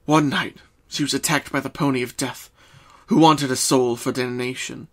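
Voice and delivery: usual speaking voice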